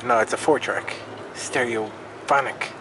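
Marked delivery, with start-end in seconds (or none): none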